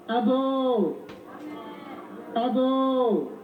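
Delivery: long drawn-out, sustained call; cry for attention. Shown as a demonstration. Speaker demonstrating a Ghanaian cry for attention, held-out call. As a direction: call out with a held last vowel. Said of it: "This is a cry for attention".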